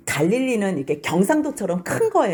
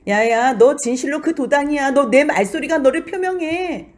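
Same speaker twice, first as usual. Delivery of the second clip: accusing bystander; 6 semitones higher, 4 dB louder than usual. Acted. Bystander confronts Peter.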